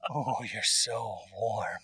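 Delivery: slow, soft seductive murmur; soft sultry tease. Pretend. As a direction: breathe it slowly, teasing. Mock sultry into earbuds.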